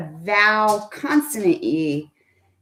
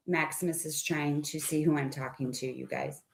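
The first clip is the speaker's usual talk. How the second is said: much softer, quick, lower aside; quiet aside; 5 semitones lower, 13 dB quieter, faster than usual. Real, not acted. Aside about the dog.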